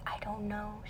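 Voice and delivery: hushed imitation of a teacher; near-whispered hesitant reply; discreet doubt. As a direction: whisper it uncertainly.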